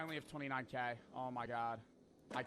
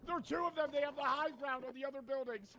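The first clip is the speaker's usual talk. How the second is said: urgent; 9 semitones higher, 4 dB louder than usual. Real, not acted. Pinned down by snipers.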